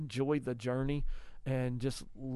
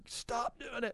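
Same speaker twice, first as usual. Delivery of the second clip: a hint of a frazzled father snapping; mock crazed dad; 10 semitones higher, 4 dB quieter, slower than usual. Father mocking his own overreaction, mild snap. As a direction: lightly play the crazed dad. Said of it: "this crazed man that I can be".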